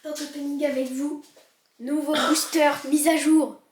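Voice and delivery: loud, eager hype; excited announcement. Real, not acted. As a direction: call it out like a reveal. Opening a pack, excited.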